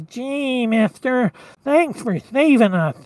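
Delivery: whiny, nasal reindeer voice; grateful little reindeer, whiny and nasal; whiny, nasally Rudolph. Acted. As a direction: thank them in a nasal cartoon whine. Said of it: "he's got that kinda really whiny, nasally voice".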